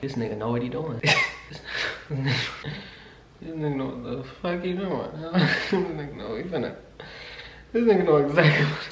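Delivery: knowing laugh. Real, not acted. Realizing his intentions.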